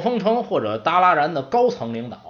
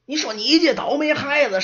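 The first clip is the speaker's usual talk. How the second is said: high, louder nagging scold; scolding old servant; 11 semitones higher, 3 dB louder than usual. Acted. Old servant scolding the apprentice for provoking the orc, nagging scold. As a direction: chew the kid out.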